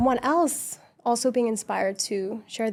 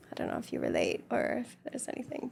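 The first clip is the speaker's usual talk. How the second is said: dropped to a whisper; 9 dB quieter than usual. Real, not acted. Trailing off unsure.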